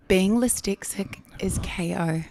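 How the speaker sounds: whispered aside. Real, not acted. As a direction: whisper it as a secret reassurance. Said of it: "whispered aside".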